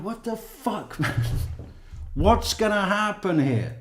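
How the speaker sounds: imitating his own stunned reply to a friend; retold disbelief. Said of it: "And I'm like".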